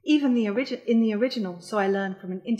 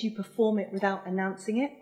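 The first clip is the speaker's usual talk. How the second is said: ordinary talk, nothing marked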